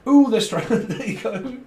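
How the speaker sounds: a high excited ooh with a laugh; excited squeal. Real, not acted. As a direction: burst out in delight. Sudden excited reaction.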